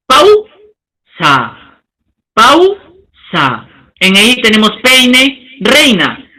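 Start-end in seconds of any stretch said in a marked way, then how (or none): 0.1-1.8 "pausa" broken slowly into pau, pause, sa; syllable-by-syllable pausa. Shown as a demonstration. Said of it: "diríamos". 2.3-3.7 "pausa" broken slowly into pau, pause, sa; syllable-by-syllable pausa. Shown as a demonstration. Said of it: "diríamos".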